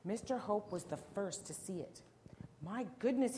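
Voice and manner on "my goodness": higher, louder exclamation of shock; storybook cry of surprise; startled cry. A narrator's reading. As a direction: exclaim in alarm as Mister Hope. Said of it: "he cried".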